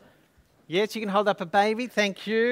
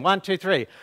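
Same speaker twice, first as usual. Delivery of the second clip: ordinary talk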